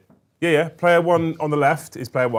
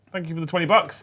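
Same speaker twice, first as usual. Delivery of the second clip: ordinary delivery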